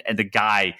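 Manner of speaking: normal talking voice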